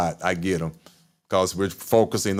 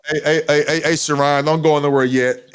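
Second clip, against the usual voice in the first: raised, eager call to viewers; 4 semitones higher, 4 dB louder than usual. Real, not acted. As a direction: call out to get attention. Calls out to live viewers.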